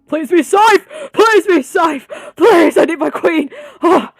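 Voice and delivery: high screaming plea, slowing on the last line; frantic begging shout; desperate pleading scream. Real, not acted. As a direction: shout in real panic for her. Fearing for favourite character.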